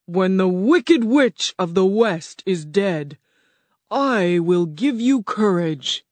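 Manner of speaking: slow, booming beast voice of Oz; grand slow roar from a huge animal. Acted. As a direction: roar it out slowly like a great beast. Oz sets a task.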